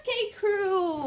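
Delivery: ordinary delivery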